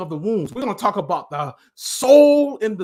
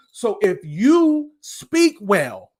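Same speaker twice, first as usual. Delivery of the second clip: usual speaking voice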